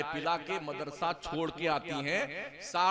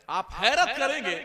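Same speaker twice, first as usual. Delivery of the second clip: louder, higher rhetorical call; emphatic exclamation; 6 semitones higher, 9 dB louder than usual. Acted. Preacher telling the crowd they will be amazed, loud and emphatic. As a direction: hit हैरत hard to stir the crowd.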